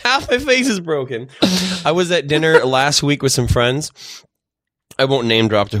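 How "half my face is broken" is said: high-pitched, exasperated insistence; higher, retold self-defence; mock exasperated protest. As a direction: plead your case in comic frustration. Retold protest at being misread.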